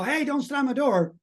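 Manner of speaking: high mock yell starting on Hey; retold yell. At the family slamming a door, a high mock yell. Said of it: "about screaming to, to the family".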